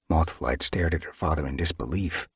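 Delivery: ordinary delivery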